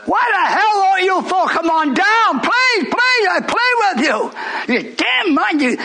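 high, pleading cry; a hint of desperate pleading; pleading preacher. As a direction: beg them to come on down. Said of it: "Paul's plea".

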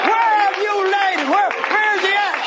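high, sustained cry; preaching shout. Acted. Preacher voicing Jesus asking for the tomb, preaching shout. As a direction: call the question out high and strong.

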